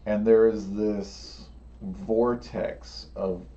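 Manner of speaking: suspenseful storytelling, voice dropping; game master scene setting. Acted.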